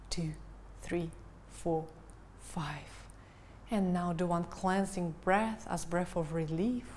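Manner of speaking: slow, calm breathing-coach voice; guided meditation. Acted. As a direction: guide them gently into the breath. Counts breathing.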